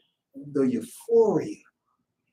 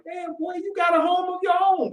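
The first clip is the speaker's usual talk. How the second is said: high, teasing host voice; a lightly high imitation of a host; imitating a host; 12 semitones higher than usual. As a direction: tease the guest like a welcoming host. Host voiced welcoming a guest.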